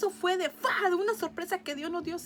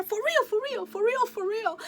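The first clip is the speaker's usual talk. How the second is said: high, louder run of astonished questions; mock-incredulous repeats, pitched up; imitated repeated disbelief; 7 semitones higher, 4 dB louder than usual. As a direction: keep asking as if unable to believe it. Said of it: "Y me decía".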